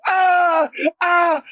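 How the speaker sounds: high piercing scream of terror; terrified scream. Retold or imitated. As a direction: scream waking from a nightmare. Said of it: "I, I screamed".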